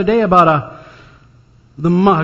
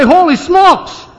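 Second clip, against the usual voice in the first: amazed exclaim; 11 semitones higher, 4 dB louder, slower than usual. Acted. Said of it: "I say".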